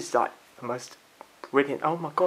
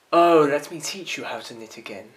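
loud declaiming; 3 semitones lower, 9 dB louder than usual. Acted. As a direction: proclaim it loudly like an orator. Monologue from the top.

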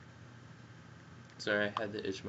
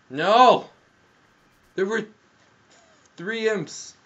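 loud, higher protest; frustrated cry; 11 semitones higher, 15 dB louder, slower than usual. Real, not acted. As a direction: yell at being outnumbered. Ambushed by imps.

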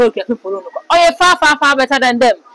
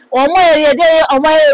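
mock wailing; 6 semitones higher, 3 dB louder than usual. Mocking celebs crying out.

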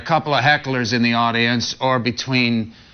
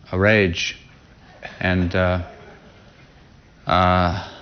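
subdued and remorseful; 5 semitones lower than usual. Real, not acted. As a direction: speak low and ashamed, with long pauses. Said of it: "I'm not doing too good".

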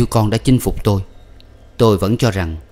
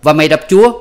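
much higher, loud disbelief; shocked outburst; 7 semitones higher, 7 dB louder, much faster than usual. A narrator's reading. Adviser shocked at the husband, loud disbelief. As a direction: gasp it out in outrage.

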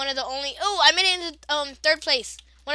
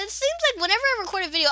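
normal talking voice

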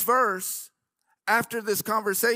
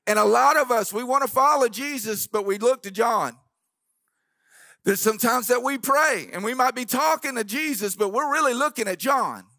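rising, louder sermon delivery with organ behind; preaching at full force; 4 semitones higher, 4 dB louder than usual. Acted. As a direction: drive the point home to the congregation.

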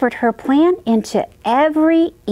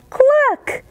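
high clucking hen; 14 semitones higher than usual. Acted. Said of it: "And the hen said".

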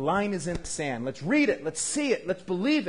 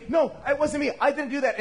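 put-on high, louder childish denial; defensive Sarah; 9 semitones higher, 3 dB louder than usual. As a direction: protest like a caught kid. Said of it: "she starts saying".

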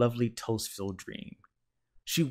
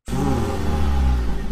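low, loud revving car noise; engine vroom; 7 semitones lower, 10 dB louder than usual. A narrator's reading. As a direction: rumble it like a race car engine. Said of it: "said Mister Watson in his sleep".

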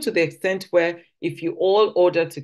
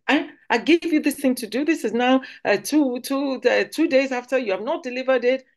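blunt Nigerian manager; 10 semitones higher than usual. Shown as a demonstration. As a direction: demand it straight out, no softening. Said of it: "In the Nigerian context, that's not how we would say. We say".

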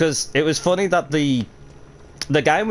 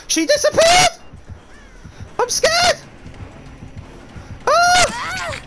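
panicked shrieking; slower than usual. Real, not acted. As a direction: shriek in alarm as everything glitches. Panicking at glitchy game.

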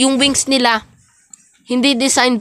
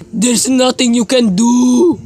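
menacing demon; 3 dB louder, slower than usual. Acted. Demon figure taunting in game.

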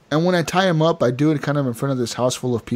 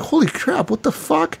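high startled yelp, then high disbelief; mock shock; 13 semitones higher than usual. Player pulled off his horse, high startled mock shock. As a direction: yelp it like you just got punched off your horse.

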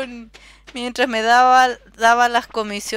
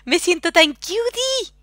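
cutesy voice; 8 semitones higher, slower than usual. Pretend. Cutesy voice about fanart.

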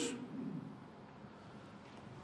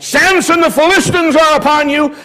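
high, loud alarm call; crying out; 8 semitones higher, 5 dB louder than usual. Acted. Delilah warning Samson of attackers, crying out loud. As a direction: sound the false alarm to wake him. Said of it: "she cries out".

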